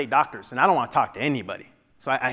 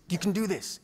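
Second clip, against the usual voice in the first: soft, higher-pitched encouragement; hushed urging; 4 semitones higher, 7 dB quieter than usual. Retold or imitated. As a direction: imitate a teammate quietly pushing him. Teammate urging him on quietly.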